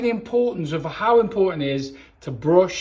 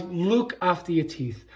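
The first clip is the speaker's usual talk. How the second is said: ordinary delivery, nothing marked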